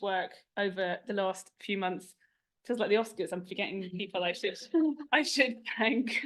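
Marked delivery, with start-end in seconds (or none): 4.8-6.2 laughing, slower and higher; drawn-out words with a laugh; amused. Real, not acted. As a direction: chuckle through the words after a slip. Laughs at forgetting names in speech.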